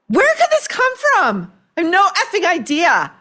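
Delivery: mock-innocent shrug, voice jumping high; sarcastic mock bafflement. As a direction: play dumb, dripping sarcasm. Sarcastic mock of Ryan's claim.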